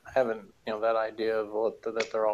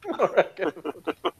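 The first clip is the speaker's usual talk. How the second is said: giggling through the words, higher; laughing; 6 semitones higher, 5 dB louder than usual. Real, not acted. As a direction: laugh as you repeat the joke. Repeats joke while laughing.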